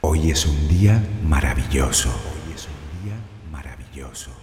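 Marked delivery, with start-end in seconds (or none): 2.1-4.3 distant, very soft repeat of an affirmation; fading echo. Acted. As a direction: let each repeat recede further away.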